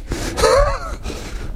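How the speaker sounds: high laugh trailing off. Real, not acted. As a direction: laugh again, dying away. Laughing at his own joke.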